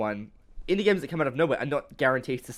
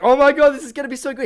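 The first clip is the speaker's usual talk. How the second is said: much higher, louder and fast fanboy gush; giddy excitement; 12 semitones higher, 10 dB louder, faster than usual. Retold or imitated. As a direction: gush like a hyped fan watching a trailer. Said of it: "we're like".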